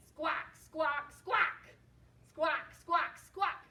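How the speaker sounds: short, loud squawks; penguin squawk. Shown as a demonstration. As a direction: squawk in threes for children to copy. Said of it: "Can you squawk like a penguin".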